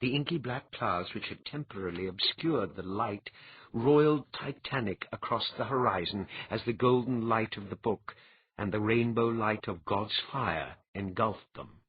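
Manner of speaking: usual speaking voice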